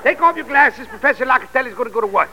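hammed-up Italian accent; Italian-accented janitor-professor teasing his pupil. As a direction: play a jolly Italian music professor.

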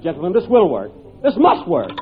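ordinary delivery

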